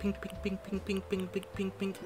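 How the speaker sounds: high, light "pink pink pink" taps like a finger on glass; imitated glass tapping. Telling how the YouTuber tapped the shrimp tank, high tapping noises. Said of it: "he'd go like".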